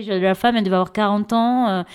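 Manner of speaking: ordinary talk, nothing marked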